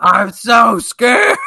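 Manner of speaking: high, slow hammed-up fright; mock scared. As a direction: stretch it out like a scared child.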